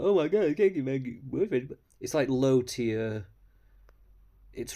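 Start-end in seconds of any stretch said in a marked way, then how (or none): 0.0-1.7 louder, higher mocking whine; mocking impression. Mocking the storyteller's punchline, louder and higher. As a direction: parody her as a ditzy complainer. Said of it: "She's like".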